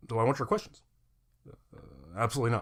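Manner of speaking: lightly imitated presidential delivery; a mock politician fielding questions; hint of a presidential impression; Obama. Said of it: "I'm a Barack Obama".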